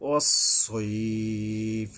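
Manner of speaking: ওয়াসসাইফ slow with a firm emphatic স; slow chanted ওয়াসসাইফ; careful sad. Shown as a demonstration. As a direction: recite ওয়াসসাইফ slowly, stressing the heavy স. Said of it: "সদ উচ্চারণে সাবধান হতে হবে".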